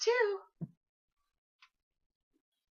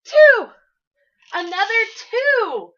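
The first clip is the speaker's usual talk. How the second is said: high, loud delighted count; excited find; 10 semitones higher, 10 dB louder than usual. Real, not acted. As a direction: pop the number out with delight. Finding the second letter.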